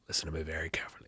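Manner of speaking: low, whispered menace; Liam Neeson impression. Listener quietly imitating the actor's famous line, whispered low. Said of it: "trying to do his American accent".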